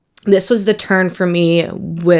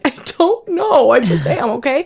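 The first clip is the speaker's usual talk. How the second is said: mock tearful; 7 semitones higher than usual. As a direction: imitate yourself crying, high and wavering. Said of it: "[name], why are you crying?".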